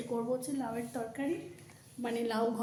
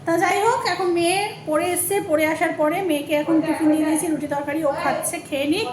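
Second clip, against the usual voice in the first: raised voice over background noise; speaking loudly; 6 semitones higher, 8 dB louder than usual. Real, not acted. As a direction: push your voice over a barking dog. Talking over the barking dog.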